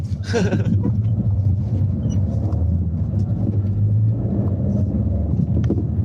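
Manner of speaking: long low wheezing laugh; drawn-out real laughter. Real, not acted. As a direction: laugh it out at length.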